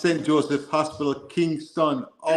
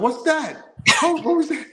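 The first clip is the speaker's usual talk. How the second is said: high, amused disbelief; mock friends reacting to the accent switch; friends surprised; 11 semitones higher, 3 dB louder than usual. Friends reacting to his Jamaican accent, high mock surprise. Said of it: "my friends were like".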